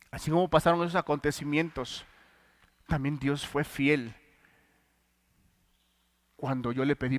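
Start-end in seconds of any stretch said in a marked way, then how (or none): none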